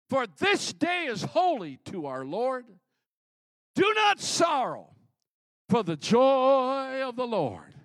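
high, loud, slow climactic preaching; preaching at full force. Real, not acted. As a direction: build to a roaring preacher climax. Climax of sermon reading.

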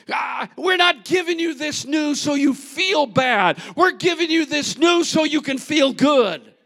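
loud, high preaching as Nehemiah; voiced Nehemiah, shouting. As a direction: command them to rejoice. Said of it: "he began to say".

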